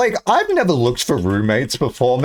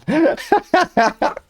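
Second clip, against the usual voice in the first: squealing laugh; high burst of laughter; 14 semitones higher than usual. Real, not acted. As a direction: break into a high giggle. Reacts to chat suggestion.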